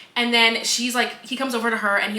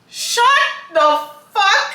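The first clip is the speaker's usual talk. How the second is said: drawn-out, rising, louder disbelief; exasperated outburst; 7 semitones higher, 6 dB louder, slower than usual. Real, not acted. Reader reacting to an overused book line, loudly exasperated. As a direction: build to a high, loud last word.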